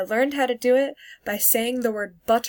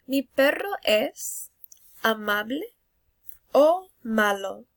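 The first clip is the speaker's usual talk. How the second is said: slow teacher question; 3 dB louder, slower than usual. Shown as a demonstration. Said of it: "teacher asks class".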